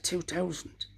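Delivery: high, soft astonished echo; a man realising how long he's been homeless; stunned disbelief. Retold or imitated. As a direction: repeat the year as if it can't be true. Stunned at the year.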